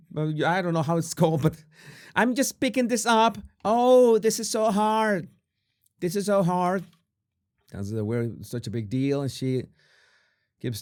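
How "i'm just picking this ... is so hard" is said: put-on high, complaining Valley Girl; Valley Girl accent. Host as Megan struggling with cooking, mock Valley Girl accent. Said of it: "Valley Girl accent".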